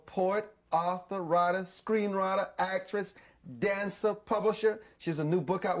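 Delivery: upbeat, projected show ident before the break; TV host announcement. Real, not acted. Host introducing his special guest to viewers before the break, announcer delivery. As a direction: roll through the guest's credits with presenter polish.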